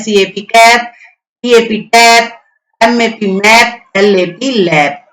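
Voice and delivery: slow letter-by-letter spelling, then the word; spelling out slowly. Shown as a demonstration. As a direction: spell it slowly for children to repeat. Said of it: "जोर जोर से बोलना है इन words को". (धीरे-धीरे spelling बोलना)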